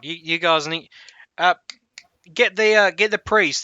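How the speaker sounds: loud high call to fetch the priest; urgent shout. Acted. Half-orc orders guards to fetch priest, shouting. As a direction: yell the order down the hall.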